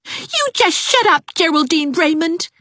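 put-on high, sharp girl's retort; high angry girl; snapping child. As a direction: tell her off, cross little girl. Ivy snaps at Geraldine.

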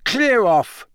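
loud, high, angry snap; snapped. A narrator's reading. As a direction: shoo them away sharply. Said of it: "Ron snapped at them".

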